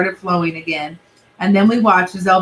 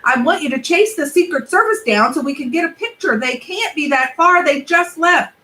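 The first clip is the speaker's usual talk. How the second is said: yelled; 7 semitones higher than usual. Acted. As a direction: shout it out, urgent. Boy urging mom.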